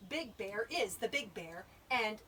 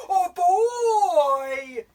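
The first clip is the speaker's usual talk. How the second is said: loud, high, long-drawn holler; yell; 9 semitones higher, 21 dB louder than usual. A narrator's reading. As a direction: yell it out as the astonished bear. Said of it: "yelled the bear".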